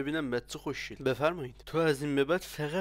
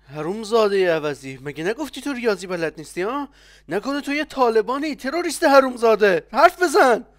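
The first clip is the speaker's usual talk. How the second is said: rising, much louder and higher shouting; furious shout; 7 semitones higher, 12 dB louder than usual. Acted. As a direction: scream the accusation, building to the top. Accusing the old man of cheating. (فریاد خشمگین و بلند)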